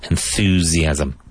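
Enthusiasm drawn out slowly, clear TH, both s sounds buzzing as z; deliberate teacher model of Enthusiasm; slow model pronunciation. Shown as a demonstration. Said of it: "Both S's in that word sound like a Z".

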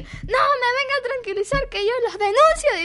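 high, near-tearful threat; mother threatening the teachers, hammed up; mock furious mother. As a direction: play the mother losing it. Mother threatens to report them.

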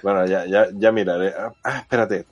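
ordinary talk

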